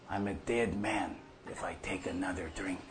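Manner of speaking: hushed, breathy retelling of the doctor; grim whispered warning. Retold or imitated. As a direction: drop to a whisper for the doctor's words.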